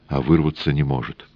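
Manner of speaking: ordinary delivery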